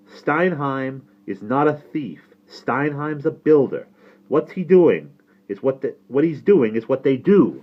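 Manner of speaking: firm, insistent defence; father arguing back. Acted. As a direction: correct your nephew point by point. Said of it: "my father said".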